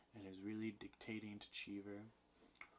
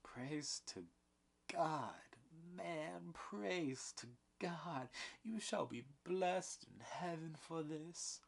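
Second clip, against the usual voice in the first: high, slow, overjoyed praise; higher, relieved, thankful cry; great relief and gratitude; 8 semitones higher, slower than usual. A narrator's reading. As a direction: lift the voice in grateful relief. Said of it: "with great relief and gratitude".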